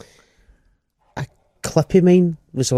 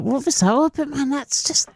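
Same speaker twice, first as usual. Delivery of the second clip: much higher, incredulous; mock disbelief; 12 semitones higher than usual. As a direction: squeak it out in disbelief. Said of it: "I was like".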